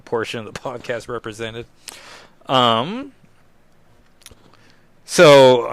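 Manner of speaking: normal talking voice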